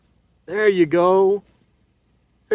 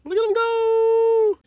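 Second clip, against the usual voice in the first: drawn-out falsetto cheer; sing-song falsetto marvelling; playful high squeal; slower than usual. Real, not acted. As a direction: stretch it out, very high and slow. Cheering on the released crayfish.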